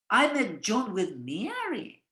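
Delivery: "Mary" marked, line said slowly; slow sentence landing on "Mary"; stress on Mary. Shown as a demonstration. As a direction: focus on "Mary", not Jane. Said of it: "not with Jane".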